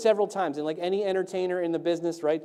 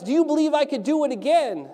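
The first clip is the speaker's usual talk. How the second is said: high, louder call to the crowd; showman's shout; 7 semitones higher, 4 dB louder than usual. Acted. Tightrope walker challenging the crowd, shouting. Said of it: "and he shouts".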